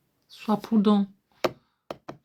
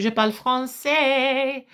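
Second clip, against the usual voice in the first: slow, higher, drawn-out boast; triumphant boast; 4 semitones higher, slower than usual. Real, not acted. Woman answering those who doubted her French, triumphant boast. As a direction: show off, stretching the last word.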